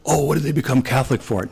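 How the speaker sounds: mock a begrudging churchgoer; a hint of a sniffy complaint; grumbling disapproval. Shown as a demonstration.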